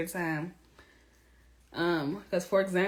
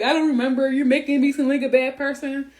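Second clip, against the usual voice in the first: mock whiny man; 5 semitones higher, 6 dB louder than usual. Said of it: "And he was like".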